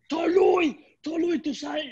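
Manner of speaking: high, pushed mock scolding; imitating Jeter. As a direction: imitate an irritated star pointing to a teammate. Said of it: "como él le dijo a las mujeres".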